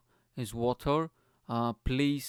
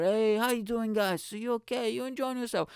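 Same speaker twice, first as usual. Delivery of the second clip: high-pitched mock frontman hail; stage greeting; 9 semitones higher than usual. Singer to bar guys, high hyped-up greeting. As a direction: work the crowd as the band starts.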